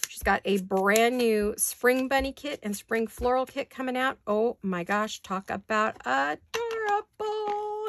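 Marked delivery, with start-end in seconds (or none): 5.2-7.9 gushing. Real, not acted. Gushing over new craft kit.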